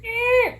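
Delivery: tiny chick cheep, very high; high-pitched chick chirp; high baby bird squeak. Retold or imitated. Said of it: "I hear, like".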